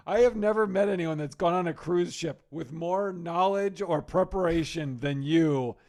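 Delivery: loud, exasperated mock retort. Comedian answering his over-prepared friend's gripe, exasperated. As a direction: fire back loudly, incredulous at the complaint.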